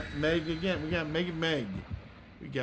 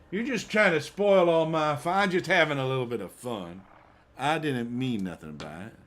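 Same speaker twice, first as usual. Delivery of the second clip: put-on hillbilly accent; 5 dB louder than usual. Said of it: "I'm talking like a hillbilly".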